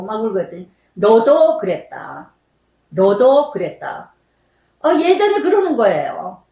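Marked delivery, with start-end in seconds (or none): 0.9-4.1 slow, grave answer from above; a slow, solemn rebuke from God. Retold or imitated. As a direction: speak as God, slow and weighty. God answers her prayer.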